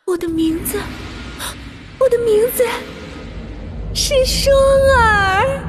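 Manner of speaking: high, manic laughing speech; crazed laughter. Acted. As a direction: laugh madly through the words. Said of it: "无名笑了".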